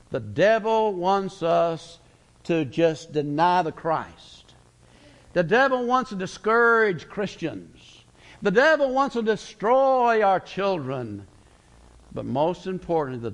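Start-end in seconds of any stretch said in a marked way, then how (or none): 0.1-11.3 slow, rising preacher's build; preaching. Acted. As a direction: hammer each devil line slower and higher.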